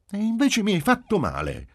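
very high, loud insistence; child's high, emphatic protest; emphatic little girl. A narrator's reading. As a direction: contradict Dad with emphasis. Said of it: "ella rispose con enfasi".

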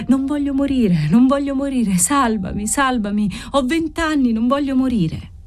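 high, desperate pleading; a delirious girl begging not to die; desperate plea. A narrator's reading. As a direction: beg for your life, terrified. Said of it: "quelle parole disperate".

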